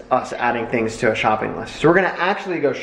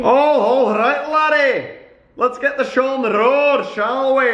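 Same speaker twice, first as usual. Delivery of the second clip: sing-song showman voice; catchphrase voice; 12 semitones higher than usual. Pretend. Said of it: "That's better".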